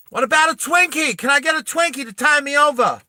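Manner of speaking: put-on high, loud whining kid voice; whiny kid. Spoiled kid begging his mom for a snack, high and whiny. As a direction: beg for a Twinkie like a spoiled brat. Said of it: "the kid goes".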